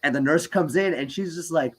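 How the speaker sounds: usual speaking voice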